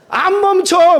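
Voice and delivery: loud, high, emphatic refusal; playfully stubborn outburst; defiant refusal. Acted. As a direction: blurt it out in mock indignation. Acts refusing to stop when hailed.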